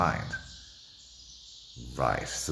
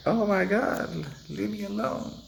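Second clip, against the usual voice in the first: high, drawn-out mock complaint; mock annoyed people. People told the truth, brushing the speaker off, high mock complaint. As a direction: imitate put-out people in a high slow whine. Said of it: "and they're like".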